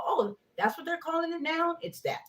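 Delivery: high, amused oh-realization; playfully surprised realization; mock realization. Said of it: "and I go".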